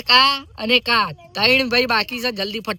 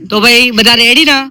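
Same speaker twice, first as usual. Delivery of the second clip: calling out; 8 dB louder than usual. Real, not acted. Host calls out to crowd.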